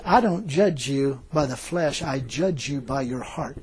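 slow, soft divine pronouncement; hushed, deliberate retelling of God's words; voice of the Lord. Retold or imitated. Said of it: "the Lord said this to me".

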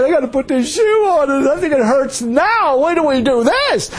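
high-pitched whining lament, hammed up; comic anguished wail. Preacher retelling dread of the shoe, mock anguished wail. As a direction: wail it high, as if close to tears.

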